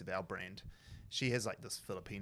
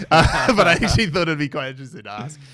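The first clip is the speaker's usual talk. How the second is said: laughing; 5 semitones higher, 20 dB louder than usual. Real, not acted. Laughing after the tangent.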